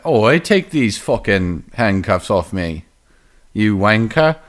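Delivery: slow, put-on British protest; hammed-up British accent, drawn out; mock British accent. Rapper orders officers to remove handcuffs, mock British. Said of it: "that's what he sounds like exactly".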